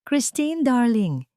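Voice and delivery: high, sugary call with poison under it; sweet but venomous. Acted. Rochelle calling Christine with a sugary, venomous lilt. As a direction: sweeten the name like a trap. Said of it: "matamis ngunit makamandag na ngiti".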